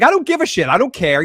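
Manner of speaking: high, mildly frantic retort; retold freak-out. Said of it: "she's freaking out".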